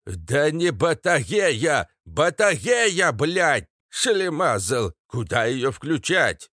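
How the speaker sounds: slow, high, burred outburst; exasperated exclamation. A narrator's reading. Katz exclaims at Astra over the battery, exasperated burred cry. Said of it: "воскликнул знахарь".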